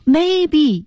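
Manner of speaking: maybe 重读并上扬; stress on maybe. Shown as a demonstration. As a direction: punch the word maybe to catch attention. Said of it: "一下强调出来了". (强调 maybe)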